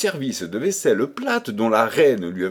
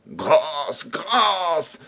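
pleading cry, pitching up on the second grâce; begging for mercy; 3 semitones higher than usual. A narrator's reading. Beaten minister begging Pierrot for mercy, pleading cry. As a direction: beg for mercy, beaten and done. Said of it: "s'écria enfin le malheureux Renardino".

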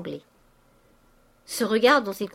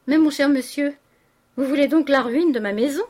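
louder, higher landlady's protest; indignant protest; 6 semitones higher, 6 dB louder than usual. A narrator's reading. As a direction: protest loudly to a dear sir. Landlady protests her ruin.

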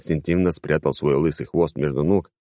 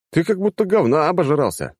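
higher, gleeful mocking hello; cheerful greeting; 11 semitones higher than usual. A narrator's reading. Hero greets the cat envoy, cheerfully mocking. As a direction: greet the enemy with glee. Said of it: "радостно поприветствовал я оппонента".

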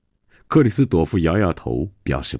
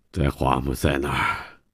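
low, soft, cowed reply; shamefaced admission; 4 semitones lower, 5 dB quieter than usual. Acted. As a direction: confess without daring to face them. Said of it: "连头也不敢抬起来". (羞愧地低声承认)

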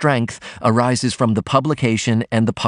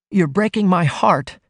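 sobbing; 8 semitones higher than usual. A narrator's reading. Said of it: "she sobbed".

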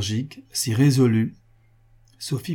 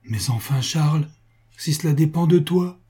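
anguished outcry; 6 semitones higher, 5 dB louder than usual. A narrator's reading. Sophie urging her husband to speak, anguished outcry. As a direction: pitch climbing in mild anguish. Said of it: "s'écria Sophie dans une angoisse inexprimable".

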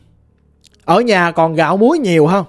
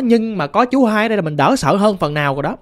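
normal talking voice

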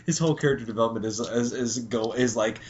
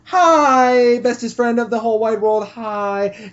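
high, loud gushing fake-sweet hi; overdone sweet-talk voice; mock sugary greeting; 9 semitones higher, 6 dB louder, slower than usual. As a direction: gush it like a pony buttering up her friend. Rainbow Dash sucking up.

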